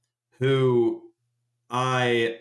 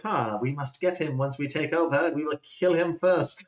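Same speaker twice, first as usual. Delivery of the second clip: put-on British accent; 3 semitones higher than usual. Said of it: "I do a British accent for that".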